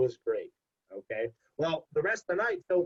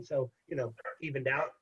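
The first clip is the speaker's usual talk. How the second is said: normal talking voice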